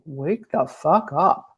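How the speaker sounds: slow, drawn-out mild mock yell; mock scream. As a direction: voice cancer's demand as a half-held scream. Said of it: "cancer is a scream. It's like".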